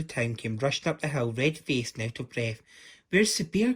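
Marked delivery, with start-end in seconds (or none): 3.1-3.7 higher, louder breathless question; winded from the climb; gasped. A narrator's reading. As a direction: townsfolk gasping after rushing uphill. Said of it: "gasped the town folks".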